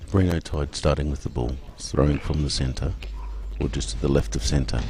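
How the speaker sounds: low, measured sports commentary; live play-by-play. Real, not acted. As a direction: call the throw as it happens, low and contained.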